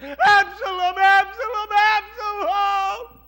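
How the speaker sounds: high, loud drawn-out crying of the name; keen the name, stretching the last; a father wailing his son's name; grieving wail. Acted. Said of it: "They heard".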